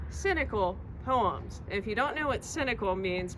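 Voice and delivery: ordinary delivery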